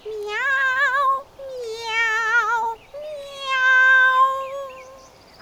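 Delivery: long, very high-pitched meows; cat meowing. A narrator's reading. Cat shows the donkey her singing, meowing. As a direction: meow like a cat singing at the moon. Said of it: "iniziò a miagolare".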